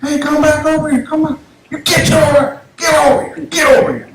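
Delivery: high-pitched calling out to dogs; imitated owner's call, high and insistent. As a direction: holler for the dogs to come back. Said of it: "I hear the owner calling the dogs".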